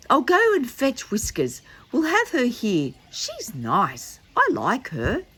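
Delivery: put-on high eager little boy; a lightly voiced little boy offering help; Noddy. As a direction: lift the voice up for Noddy.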